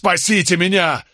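high desperate yell; yelling at the top of his lungs. A narrator's reading. Condemned man yells to the narrator and Nicholas for rescue. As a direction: scream for rescue. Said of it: "заорал во всю глотку".

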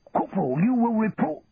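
a hint of a barking sergeant; lightly voiced stern order; sergeant major. A narrator's reading.

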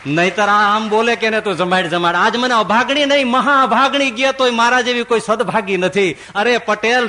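loud, high cry of joy; overjoyed wife. Acted. Wife rejoicing loudly at her husband's change. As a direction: burst out in grateful joy. Wife's joyful inner cry.

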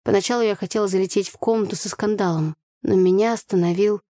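plain reading voice

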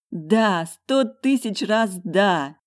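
high, slow, joyful yes. A narrator's reading. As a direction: pour out happy acceptance. Joyful acceptance.

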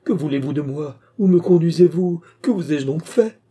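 high, desperate questioning; sudden despair. A narrator's reading. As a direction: plead loudly in rising desperation. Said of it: "en proie à un subit désespoir".

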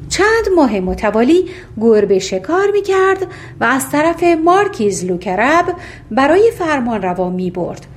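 plain reading voice

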